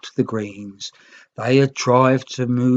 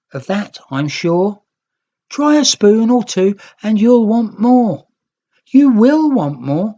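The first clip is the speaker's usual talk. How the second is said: raised, louder teasing voice; young Green teasing; 8 semitones higher, 5 dB louder than usual. A narrator's reading. Young Green teasing the Reds about stew, playful. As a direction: tease them into trying the stew. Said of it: "poking fun".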